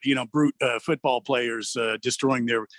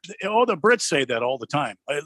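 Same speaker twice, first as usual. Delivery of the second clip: ordinary talk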